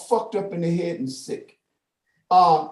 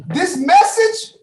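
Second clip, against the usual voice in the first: mock dramatic; 9 semitones higher, 6 dB louder, much slower than usual. Parodying Mission Impossible tape message.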